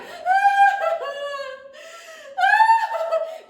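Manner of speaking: loud high hammed-up wail; wailing sob. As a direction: howl a comic sob. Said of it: "the dragonfly started to cry".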